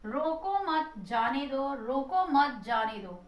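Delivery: रोको मत जाने दो read slowly, no comma; measured, neutral dictation of the example; slow sentence readout. Shown as a demonstration. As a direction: stretch each word of "रोको मत जाने दो" evenly. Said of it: "ये दो वाक्य लिखे हैं".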